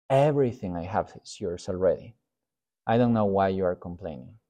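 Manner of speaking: quiet, gentle father soothing a complaining son. Retold or imitated. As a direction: tenderly remind the son. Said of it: "And God goes".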